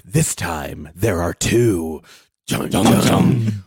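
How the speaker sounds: epic announcer voice, barely holding it together; movie trailer voice. Pretend. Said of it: "are you gonna read it in his voice?".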